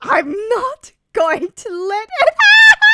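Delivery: loud, high cheer; hyped shout. Real, not acted. Fan cheering the heroes on, loud and high. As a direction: yell it out fired up.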